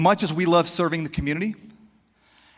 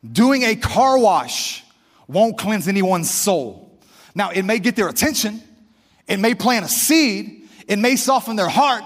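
preaching; 4 semitones higher, 3 dB louder than usual. Real, not acted. Preaching cadence.

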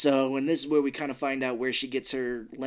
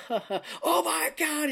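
high mock freak out; much slower than usual. Retelling a freak out.

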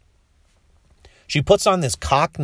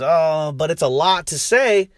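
higher, louder hesitant protest; mock reluctant Jack; 6 semitones higher, 4 dB louder than usual. Jack protesting to Mary Poppins, mildly hammed-up. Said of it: "And he goes".

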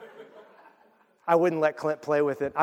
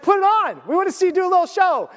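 hammed-up high, loud urging; gleeful dad; 6 dB louder than usual. Dad demanding a puppet show, hammed-up glee. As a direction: imitate an excited parent egging the kid on.

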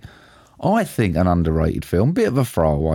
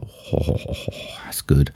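breathy mock chuckle; 3 semitones lower, 4 dB quieter than usual. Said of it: "and I was like".

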